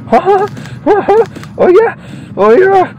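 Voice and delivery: high, swaggering growl of approval; cocky wrestler voice. Pretend. Gamer voicing his wrestler, high swaggering brag. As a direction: brag like a ring showman.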